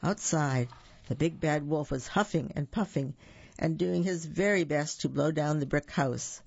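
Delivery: ordinary delivery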